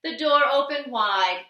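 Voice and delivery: usual speaking voice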